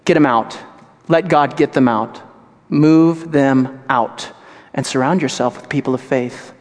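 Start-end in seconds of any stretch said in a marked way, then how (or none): none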